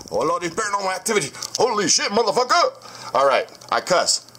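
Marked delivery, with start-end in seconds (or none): 0.1-3.1 sudden high shocked yelp; startled outburst. Real, not acted. Vlogger spots paranormal activity on camera, shocked high yelp. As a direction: jolt up in pitch, real fright.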